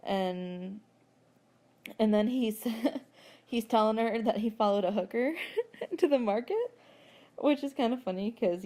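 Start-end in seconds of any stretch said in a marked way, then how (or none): none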